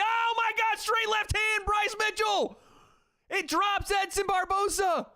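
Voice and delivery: real play-by-play roar at the knockdown; excited shout. Real, not acted. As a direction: explode as the left hand lands. Knockdown in live fight commentary.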